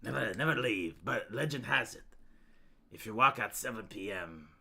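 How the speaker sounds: low, hushed campfire legend voice; whispered urban legend; spooky storyteller. Acted. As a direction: tell it like a ghost story, hushed. Ghost story telling.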